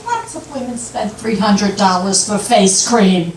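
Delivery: unruffled wife's retort, acted; calm defensive reply; matter-of-fact comeback. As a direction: answer the outrage evenly, in an everyday pitch. Wife's calm comeback to outraged husband.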